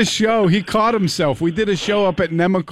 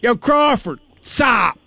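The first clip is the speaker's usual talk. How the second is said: mild mock yell, pitched up; raised-pitch call to a passerby; imitated yell; 5 semitones higher, slower than usual. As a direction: imitate someone hollering from a car. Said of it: "yelled out of his car".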